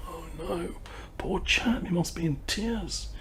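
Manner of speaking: whispered dismay; whispered thought. Retold or imitated. The shopkeeper thinks of the customer in a whisper. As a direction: hush it, a sympathetic whisper. Inner thought on hearing the news.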